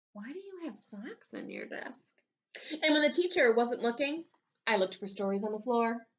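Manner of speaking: ordinary delivery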